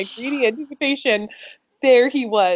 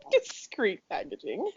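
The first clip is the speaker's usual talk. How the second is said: soft, slow words breaking into laughter; slowed, hushed laugh-through-words; 9 dB quieter, slower than usual. Real, not acted. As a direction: barely get it out through giggles. Reader repeats a phrase, cracking up.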